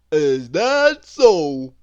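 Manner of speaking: loud, slow, dopey character voice; Mister Dizzy voice. A narrator's reading. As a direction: drag it out, baffled and loud. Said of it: "said Mister Dizzy".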